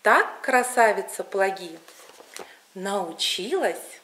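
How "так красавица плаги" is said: ordinary delivery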